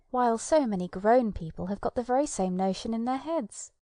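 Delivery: usual speaking voice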